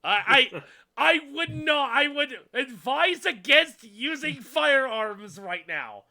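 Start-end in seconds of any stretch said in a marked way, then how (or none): 1.9-6.1 stern warning. Acted. GM NPC warning.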